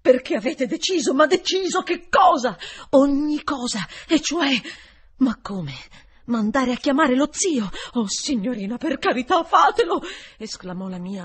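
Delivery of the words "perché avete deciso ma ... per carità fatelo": higher and louder, building to a cry; agitated pleading. A narrator's reading. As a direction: beg her, rising in urgency. (supplica agitata che cresce fino al grido)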